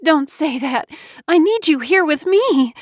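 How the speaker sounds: high-pitched, distraught pleading; anguished cry, rising in force. A narrator's reading. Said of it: "JoBeth cried".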